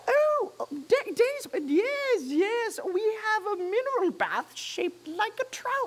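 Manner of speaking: high fluttery gentleman voice; flustered posh hatter. Acted.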